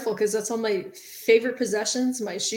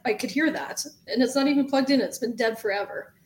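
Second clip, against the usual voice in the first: usual speaking voice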